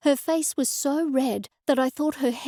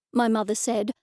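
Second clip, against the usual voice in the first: plain reading voice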